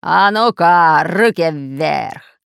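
slow, loud threatening command; menacing shout. A narrator's reading. Pirate surprises the heroes at gunpoint, menacing drawn-out shout. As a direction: draw out the order with menace. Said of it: "раздался грозный окрик".

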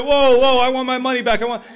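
high, loud mock protest; hammed-up yell over the laughter; crowd outcry. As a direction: shout like a cheated audience. Acting outraged crowd demanding refund.

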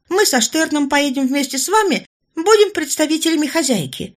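a confident court lady talking the major round; persuasive lady-in-waiting. Acted. Orlova's persuasive speech to the major.

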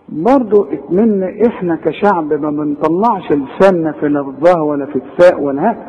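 ordinary talk

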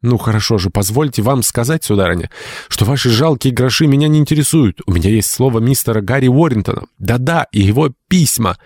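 high-pitched, defiant declaration; rebellious. A narrator's reading. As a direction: stand up to the aunt indignantly. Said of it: "внезапно взбунтовавшись".

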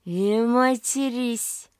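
put-on drunk slurring. A narrator's reading. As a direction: slur it like a drunk mother. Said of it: "У неё заплетается язык". (пьяная невнятная речь)

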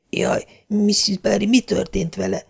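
high, soft panting voice; Marilla breathless. A narrator's reading. Marilla running to Mrs. Barry, panting and frightened. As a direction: gasp it out in fright. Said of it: "lihegte hamu szürke arccal".

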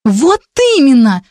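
high-pitched exclamation; emphatic agreement. A narrator's reading. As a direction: burst out in indignant agreement. Exclaims, throwing up her hands. (возмущённое согласие)

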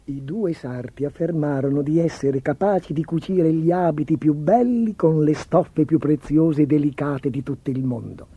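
ordinary delivery, nothing marked

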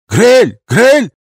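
loud, high baffled cries; startled shouts. Acted. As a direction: cry out in shocked confusion. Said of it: "послышались обескураженные голоса".

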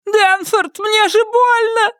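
the wife yelping in pain; cries of pain. A narrator's reading. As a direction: squeal as he pulls her hair. Myrtle pleading in pain.

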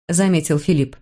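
usual speaking voice

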